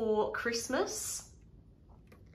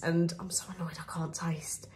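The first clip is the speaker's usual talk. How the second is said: annoyed whisper under her breath; 2 semitones lower, 8 dB quieter than usual. Real, not acted. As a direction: whisper it out, fed up. Annoyed aside, dropped to whisper.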